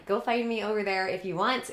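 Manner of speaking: ordinary delivery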